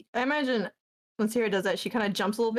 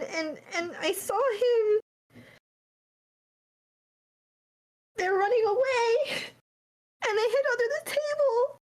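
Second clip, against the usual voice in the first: much higher, breaking, tearful telling; crying; 11 semitones higher than usual. Acted. Leaf explaining the vision to Saheera through full crying. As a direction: sob it out while trying to explain. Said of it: "She's full on crying".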